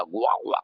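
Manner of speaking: mock vomiting noises; storyteller's "gua, gua" retch; imitated retching. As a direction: act out throwing up. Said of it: "जैसे पूरा उल्टी हो गया उसको".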